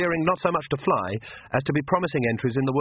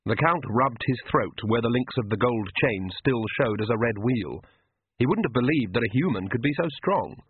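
ordinary delivery

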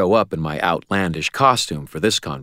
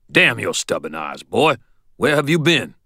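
gruff shout; 6 semitones higher, 4 dB louder than usual. Acted. Ebenezer shouting at him.

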